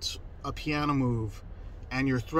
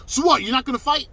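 high, loud challenge in imitation; pitched-up, belligerent impersonation; loud, imitated aggressor; 9 semitones higher, 6 dB louder than usual. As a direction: mimic his goading disbelief. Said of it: "this is me imitating him".

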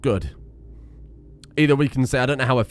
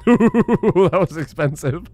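laughing; 7 semitones higher, much slower than usual. Real, not acted. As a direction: laugh through the words at the cost. Laughs at costly troop raise.

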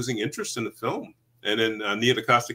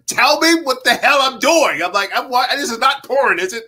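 exasperated demand; 13 semitones higher, 11 dB louder than usual. Retold or imitated. Actor pressing grinning producers, loudly exasperated. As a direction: demand it at a near shout.

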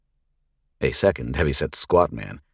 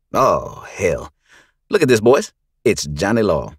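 higher, louder sneering drawl; mocking thug; 5 semitones higher, 6 dB louder than usual. Gang leader mocking the sheriff to his boys, loud sneer.

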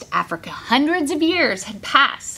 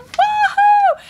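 very high, loud woohoo; excited cheer; 6 dB louder than usual. Real, not acted. Cheers about party hats.

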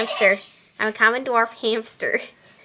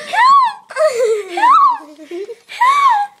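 high, loud screams for help; screaming for help; 6 dB louder, much slower than usual. Pretend.

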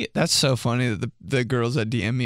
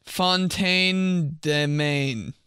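usual speaking voice

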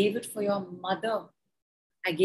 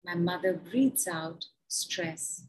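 slow, measured guided statements; guided affirmation; 3 dB quieter, slower than usual. Acted. Guiding the group through breath work, slow and measured. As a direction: lay each phrase down slowly for the group.

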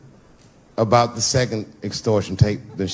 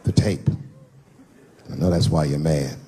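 pleading husband; 6 semitones lower than usual. Retold or imitated. Husband begging his wife to talk. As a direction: plead gently down low. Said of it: "I said".

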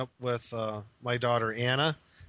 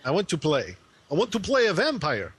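hammy vampire count; 3 semitones higher than usual. Pretend. Asking to play a vampire, hammed-up count voice. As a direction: put on a cartoon vampire count. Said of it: "sound like that dude that counts on Sesame Street".

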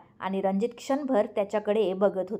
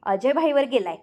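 louder, higher, lightly amused remark; amused teasing; 4 semitones higher, 6 dB louder than usual. A narrator's reading. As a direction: tease with a touch of amusement. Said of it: "दीप हसत म्हणाला".

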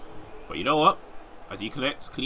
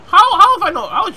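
loud, high stammering protest; exasperated disbelief; 15 dB louder than usual. Real, not acted. Gamer unable to eliminate a wrestler, high exasperated yell. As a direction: splutter loudly in disbelief.

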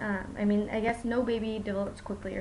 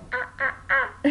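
higher monkey-ish ah sounds; toddler monkey; 4 semitones higher than usual. Retold or imitated. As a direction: mimic a toddler being a monkey. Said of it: "for monkey, he goes".

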